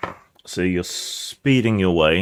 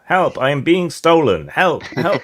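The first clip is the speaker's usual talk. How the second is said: high, loud acted alarm cries; screaming for help; 10 semitones higher, 7 dB louder than usual. Tracking device yanked from the cab's dash, mock screaming. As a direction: shriek like a panicking alarm, hammed up. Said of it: "It starts screaming in your hand".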